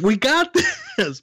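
high, laughing mock insistence; laughing through it. Retelling his reply to Miyako, laughing. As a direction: say it while breaking up laughing. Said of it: "I was like".

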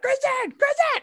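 high, squealed calls after an idol; retold fan scream. Fan calling after favorite wrestler, retold scream. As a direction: mock-scream the name, very high and urgent. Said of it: "I just screamed bloody murder".

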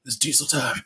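softly delivered mock catchphrase; quiet catchphrase. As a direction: imitate a quiet, deadpan reveal. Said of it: "He goes".

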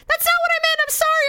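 very high, squeaky imitation of a husband; mock panicked pleading; frantic apology. Husband's apology in screaming argument.

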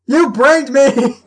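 furious woman yelling, drawn out; angry. Acted. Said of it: "like, really angry".

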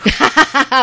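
loud high burst of laughter. Real, not acted. Reacting to a teasing reply, loud high laugh.

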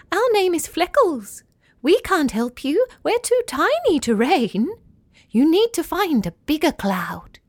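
little cloudbirds speaking as one, high; thousand tiny voices. Acted. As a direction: chirp it like many tiny clouds at once. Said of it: "replied in a thousand tiny voices".

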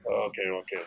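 a hint of a smug guy brushing off a flight attendant; placating. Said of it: "Girl goes".

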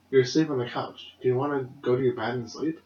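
soft, hushed coaxing; imitating a tender father at night; gentle dad. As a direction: quietly coax a sleepy kid to bed. Dad coaxing son off to bed.

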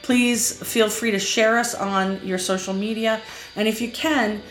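bright podcast promo voice; podcast outro read. Acted. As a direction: sell the plugs like a host signing off.

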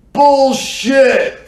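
high, loud disbelieving yell; heckling shout. Retold or imitated. Heckler doubting the claim, shouting from the back.

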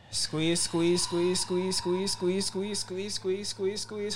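rising, urgent breathing cue; coaching breath cue. Shown as a demonstration. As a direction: drive them to squeeze out the air. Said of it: "And I'm gonna show you".

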